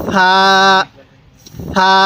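long, gentle lisped "th" opening সা; soft সা. Shown as a demonstration. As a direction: say সা softly with the tongue at the teeth, like "th" in "thin". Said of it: "সাটা নরম করে".

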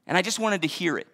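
ordinary talk

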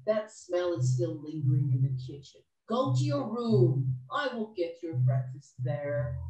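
high-pitched wife brushing off her husband; ogre's wife, dismissive. Acted. Wife explains the smell to the ogre, dismissive.

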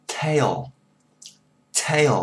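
"tail" with a long ay glide into a dark ending; "tail" with a high clear start; "tail" as two joined beats tay-o; model tail. Shown as a demonstration. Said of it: "chữ này là".

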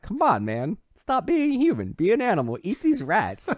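high, loud urging to be an animal; pushy coaxing. Retold or imitated. Moreau urging Braddock to eat the rats, high and pushy.

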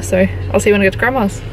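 ordinary delivery

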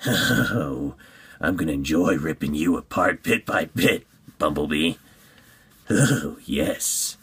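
menacing villain, savoring the threat; altered creepy villain voice; Dead End. Acted. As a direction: relish it like a sinister villain. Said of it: "this is the voice I would give him".

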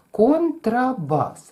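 контрабас said slowly; slow announce. Shown as a demonstration. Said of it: "є такий музичний інструмент".

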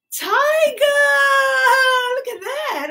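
high-pitched wonder, easing off; excited reveal. Pretend. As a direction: exclaim high with delight for children. Revealing toy tiger to children.

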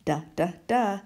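short repeated duh sounds; letter sound d. Shown as a demonstration. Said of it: "Feel the stroke of the pen".

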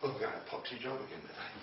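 mock weary worker. Speaker imitating a listener dragging himself to his job, soft weary grumble. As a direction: grumble it like a tired man going to work. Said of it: "get up tomorrow and go".

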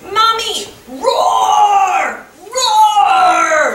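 hammed-up little boy roaring, high and loud; child roaring. Little boy roars at his mother in play, high loud shout. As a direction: shout it like a kid playing lion.

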